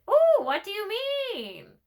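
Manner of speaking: high, slow, curious bird voice; high raven voice. Acted. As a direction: raven perks up, pitched high. First raven asks.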